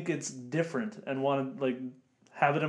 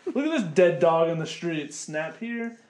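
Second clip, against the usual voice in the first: mocking tourist voice; 3 semitones higher, 5 dB louder, slower than usual. Friend mocks tourists photographing poor locals, loud sneering voice. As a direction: ham up a callous tourist gawking.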